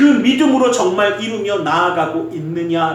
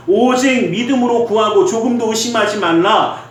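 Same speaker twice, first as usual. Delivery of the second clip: loud, raised Bible recitation; scripture recitation; 6 semitones higher, 5 dB louder than usual. Acted. Preacher quotes scripture on doubt to the congregation, loud recitation. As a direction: declaim the verse firmly, dropping on the last word.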